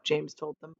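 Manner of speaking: usual speaking voice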